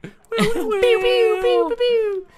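high, loud mouth gun noises; pew pew sounds. Pretend. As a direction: make laser shooting noises. Imitating bow shots.

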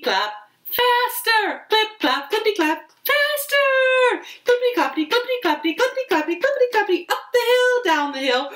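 put-on high galloping chant for the kids; speeding up. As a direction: build the gallop up excitedly.

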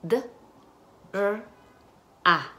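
"d, r, a" as isolated sounds with gaps. Shown as a demonstration. Teacher asks for the phonemes, sound by sound. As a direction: sound out "d, r, a" one at a time. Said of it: "Y las fonemas".

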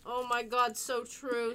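normal talking voice

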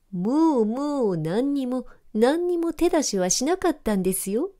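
dog parroting the cat's words to mock it; mocking mimicry. A narrator's reading. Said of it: "と口真似をすると".

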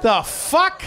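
baffled disbelief. Retold or imitated. Retold baffled reaction to [name].

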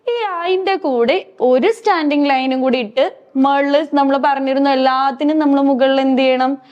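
ordinary delivery

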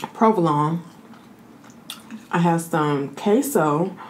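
ordinary talk, nothing marked

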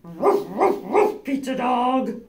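loud barking dog voice. Acted. Greeting the pizza dog at the door, loud playful barking. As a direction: bark it out like a happy dog. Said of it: "Then what would you say?".

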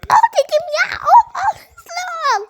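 high squeaky voice through giggles; laughing high voice. Acted. Toy character urging, laughing.

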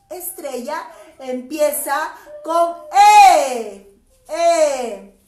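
loud, laughing spelling. Real, not acted. Teacher laughs while teaching the letter E.

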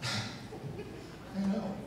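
soft breathy laughing words. Real, not acted. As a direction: laugh it out quietly. Laughing at his own line.

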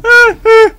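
two short high, loud yelps; frightened yelps. Real, not acted. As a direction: yelp in sudden fright. Startled in horror game.

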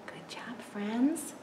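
soft whispered praise; whispering. Real, not acted. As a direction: whisper it gently. Praise after song.

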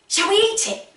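usual speaking voice

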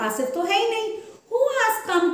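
high, alarmed surprise; wide-eyed worried surprise; surprised gasp. Acted. Mice discover owner is gone.

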